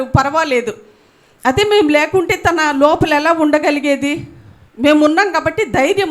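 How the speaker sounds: ordinary talk, nothing marked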